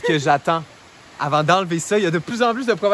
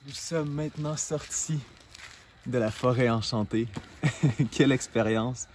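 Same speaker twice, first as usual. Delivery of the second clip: low calm narrator voice; vlog narration; 10 semitones lower, 7 dB quieter than usual. Acted.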